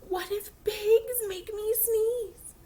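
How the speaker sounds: slow, breathy whisper of dread; fearful whisper. Acted. Gerald fearing pigs cause his sneezing, slow whisper. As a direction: whisper your worst fear slowly.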